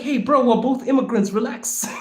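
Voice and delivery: light, high, amused appeal; high-pitched pleading. Retold or imitated. Telling a fellow immigrant to relax, high-pitched. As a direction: pitch it high, half-laughing reassurance. His reply to staring immigrants.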